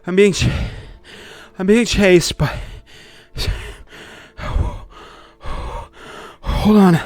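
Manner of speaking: high, loud gasped fragments; wheezing, out of breath. Acted. Fleeing man to the party, badly winded, wheezing. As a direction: shout it in bursts between heaving breaths. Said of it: "and just wheezing".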